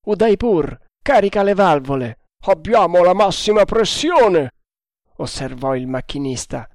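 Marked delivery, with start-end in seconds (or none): 0.1-4.5 raised, high, urgent voice; shouted exchange. A narrator's reading. Captain and engineer about the steam pressure, shouting. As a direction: call out over the engines. Shouted orders and reply aboard.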